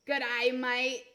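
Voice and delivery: g'day mate in Australian accent. Shown as a demonstration. Streamer testing g'day mate, Australian accent. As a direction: say it with an Aussie "maït" vowel. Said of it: "that's actually pretty accurate".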